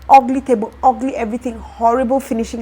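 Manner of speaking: indignant outburst. Real, not acted. Still mad at the work.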